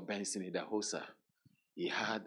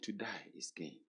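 hushed quote; 2 semitones lower, 12 dB quieter than usual. Retold or imitated. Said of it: "he said".

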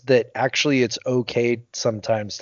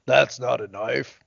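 slow put-on Australian accent; drawn-out Australian impression; Crocodile Dundee; slower than usual. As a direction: stretch out a mock Aussie movie line. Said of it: "kinda like Crocodile Dundee".

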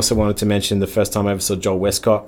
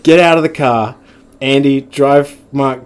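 raised, higher-pitched mock command; retold stern order; 5 semitones higher, 6 dB louder than usual. Cop mum ordering unlicensed driver out, mock-stern order. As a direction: imitate a fed-up mum ordering kids about.

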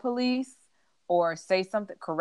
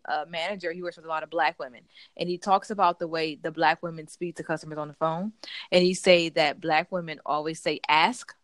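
normal talking voice

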